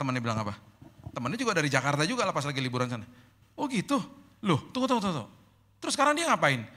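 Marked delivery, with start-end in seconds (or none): 3.5-6.7 high startled oh, then insistent wait wait; surprised friend. Retold or imitated. Friend hearing the news about the cancer, startled high interjection. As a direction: stop her mid-sentence, amazed.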